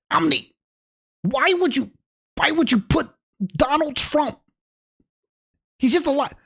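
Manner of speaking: high, put-on whine of protest; mock aghast critic. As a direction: plead in exaggerated, high-pitched dismay. Mocks viewers upset about platforming.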